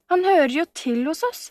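high, emphatic objection; protesting. A narrator's reading. Camilla breaking in on the letter, protesting warmly. Camilla interrupts, defending Sebastian.

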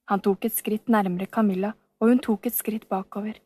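ordinary delivery

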